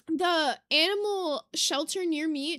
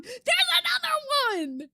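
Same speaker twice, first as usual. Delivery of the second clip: high but a bit less loud; high squeal easing off; excited repeat; 11 semitones higher, 4 dB louder than usual. Real, not acted. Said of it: "This is so exciting".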